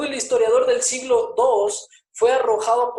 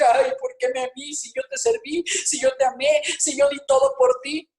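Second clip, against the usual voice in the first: whiny complaint; 5 semitones higher than usual. Retold or imitated. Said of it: "vez de decir".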